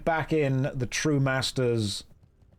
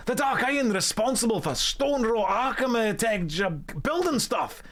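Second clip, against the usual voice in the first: excitable Scottish dwarf, pitched up; Scottish accent; 4 semitones higher than usual. Acted. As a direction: boast of a legend in a hammed-up Scottish accent. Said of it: "That was a perfect Scottish accent".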